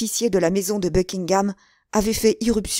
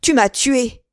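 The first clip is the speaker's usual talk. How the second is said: raised, loud accusation; anguished cry; 5 semitones higher, 6 dB louder, slower than usual. A narrator's reading. Stabbed Buckingham accuses Felton, crying out loudly. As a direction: cry out as if struck. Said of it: "cria Buckingham".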